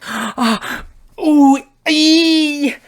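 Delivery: high pained gasp and squeak; mock wincing gasps. Vlogger imagining hitting his sore nip, high wincing gasps. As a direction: suck air in like it stings.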